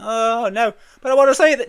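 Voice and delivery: pleading over the play-off music; mock protest. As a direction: act out a cut-off winner protesting. Acts winner cut off by music.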